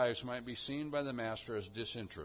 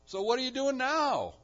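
high, louder pressing question; urgent challenge; 11 semitones higher, 7 dB louder than usual. Acted. Commentator challenging the idle, urgent raised voice. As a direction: push the listener to act now. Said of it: "And he says".

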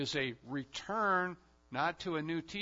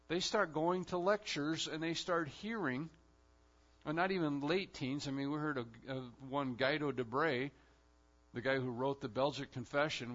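ordinary delivery

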